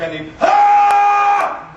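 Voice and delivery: words tearing into a long scream; slow drawn-out shriek; line into a scream. Retold or imitated. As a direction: start the line, then explode into a scream.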